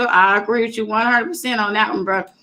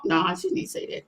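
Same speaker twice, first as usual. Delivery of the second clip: hushed aside; 7 semitones lower, 9 dB quieter than usual. Real, not acted. Aside in disbelief.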